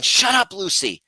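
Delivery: high, loud reenacted snap at the dog; acted-out exasperated outburst; upset yell. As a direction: replay a sharp scolding of the dog. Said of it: "me getting upset".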